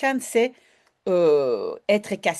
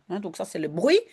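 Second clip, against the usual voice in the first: normal talking voice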